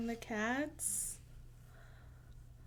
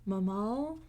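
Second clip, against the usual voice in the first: ordinary delivery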